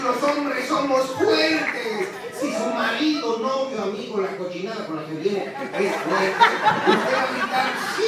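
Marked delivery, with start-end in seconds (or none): 0.0-2.1 slow, higher, louder, laughing through it; laughing emcee teasing the audience; emcee call out. Real, not acted. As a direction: drag it out like a showman. Emcee demonstrating crowd call.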